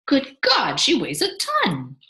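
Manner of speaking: high, loud burst then slowing; amazed, emphatic outburst; astonished exclamation. A narrator's reading. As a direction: exclaim in amazement, drawing out the end. Father amazed by baby's weight.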